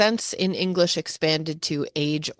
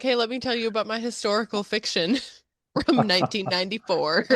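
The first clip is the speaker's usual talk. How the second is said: laughing through words; 6 semitones higher than usual. Retold or imitated. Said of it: "walking up to someone and being like".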